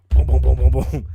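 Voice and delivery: loud rapid booming noise; explosive vocal thumps; banging imitation. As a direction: imitate pounding on a door with your voice.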